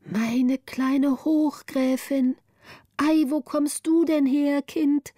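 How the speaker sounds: put-on high, slow, surprised old-woman voice; an old nurse overjoyed to see the child; delighted old woman. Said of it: "rief die Frau". (Frau Röntchen begrüßt Gritta, hoch und freudig überrascht)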